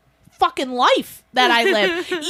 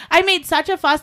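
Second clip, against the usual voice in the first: usual speaking voice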